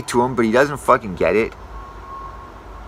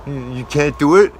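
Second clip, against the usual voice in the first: pissed-off customer; 2 semitones higher than usual. Retold or imitated. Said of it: "So he's fucking pissed about this".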